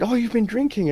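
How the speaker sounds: very high, mock-surprised cop. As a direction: play the cop pouncing on it. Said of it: "And then they said".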